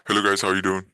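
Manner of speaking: electronically disguised greeting; voice changer. Real, not acted. Trainer greeting the group on a call, voice-changer effect. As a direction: greet them through a voice changer. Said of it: "I'm gonna use the voice changer".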